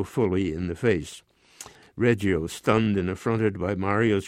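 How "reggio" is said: plain reading voice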